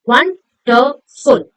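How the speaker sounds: very slow 'Difficult. Wonderful. Enjoyable.', each syllable set apart. Shown as a demonstration. Teacher showing each opening of the mouth, syllable by syllable. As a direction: break 'Difficult. Wonderful. Enjoyable.' into spaced beats. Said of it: "How many times are we opening our mouth".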